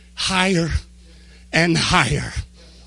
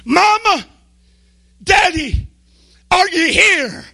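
high, loud calling out; acted frantic cry, high and loud; screaming for parents; 13 semitones higher, 9 dB louder than usual. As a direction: scream through the house for your parents. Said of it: "they walk in the door and they scream".

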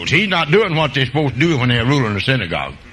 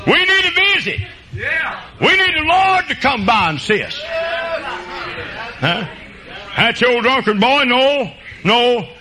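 preaching cry; 10 semitones higher, slower than usual. Real, not acted. Preacher cries out to congregation.

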